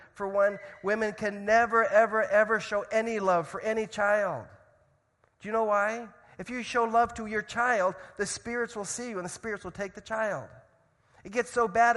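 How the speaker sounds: loud, high impassioned sermon voice; preaching. Acted. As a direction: preach it out with full force. Preaching at full force.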